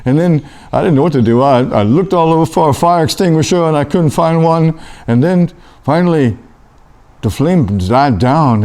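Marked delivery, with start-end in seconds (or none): none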